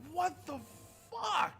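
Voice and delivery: high-pitched mock outburst; retold shock. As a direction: reenact stunned disbelief, pitch leaping up. Retells shock at cutscene.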